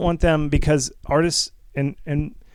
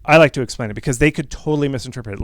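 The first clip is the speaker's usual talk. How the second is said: usual speaking voice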